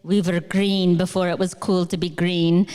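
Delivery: lightly voiced proud dad; dad's saying. Father from Kerala repeating his favorite line, lightly imitated and proud. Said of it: "as my dad likes to say".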